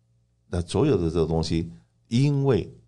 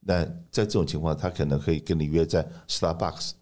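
ordinary talk, nothing marked

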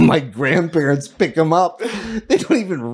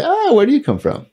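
mock local question; 7 semitones higher, faster than usual. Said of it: "they'll say".

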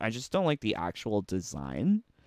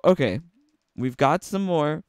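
ordinary delivery

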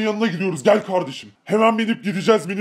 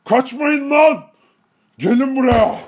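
higher, louder, slow furious call; angry shout; 5 semitones higher, 3 dB louder than usual. Acted. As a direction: roar after the runaways. Chasing the fleeing attackers. (öfkeyle bağırarak)

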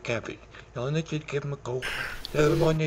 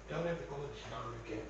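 rushed mumble; 3 semitones lower, 11 dB quieter, much faster than usual. Acted. Rushed muttered sign-off.

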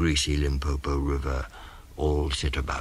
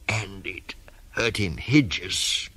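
ordinary delivery, nothing marked

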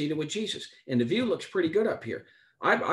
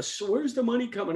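higher, a touch of imitated fretting; mock worry; 7 semitones higher than usual. Preacher mimics his own money worry, mild mock fret. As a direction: fake a worried little voice. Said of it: "worrying about all the".

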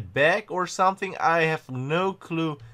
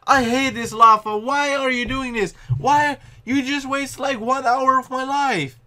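exasperated whine; 9 semitones higher, 5 dB louder than usual. Real, not acted. Gamer complains after dying.

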